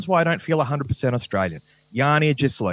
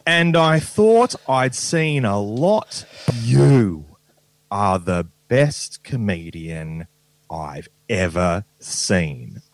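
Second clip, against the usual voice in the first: reading fan email; 7 semitones lower, 3 dB louder, much slower than usual. Retold or imitated. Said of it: "he said".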